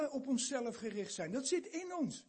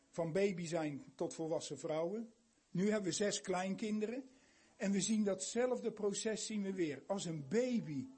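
ordinary delivery

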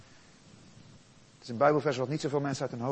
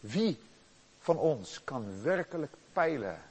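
usual speaking voice

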